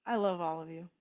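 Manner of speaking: I love all of you, repeated soft and low. Real, not acted. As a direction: say it again quietly and warmly. Repeated affection to viewers.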